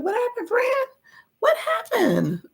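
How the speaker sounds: high, sing-song mock concern; playful high voice. Real, not acted. Host teasing a viewer about a suspended account, squeaky.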